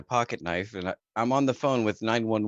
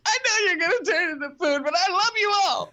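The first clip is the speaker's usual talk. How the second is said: high, wailing sob to the cows; crying goodbye; 4 dB louder than usual. Retold or imitated. Farm boy sobs goodbye to cows headed for slaughter, high wail. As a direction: bawl it out like a heartbroken kid.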